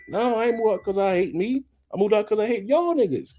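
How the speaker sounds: high-pitched, exasperated comeback; indignant retort. Acted. Neighbor explaining why he moved out, indignant and high. Acted retort to self-hate claim.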